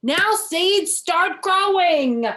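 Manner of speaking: high, loud, slow command. A narrator's reading. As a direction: call down into the ground. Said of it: "said loudly".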